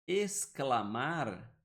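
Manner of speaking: "exclamar" slowly, each part stretched; sounded S. Shown as a demonstration. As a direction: draw out "exclamar" with a clear S. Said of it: "Tem um som de S". ("exclamar" bem devagar, com som de S)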